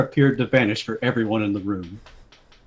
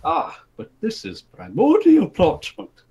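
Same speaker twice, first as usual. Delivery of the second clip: king explaining; 7 semitones higher than usual. Acted. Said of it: "the king explained".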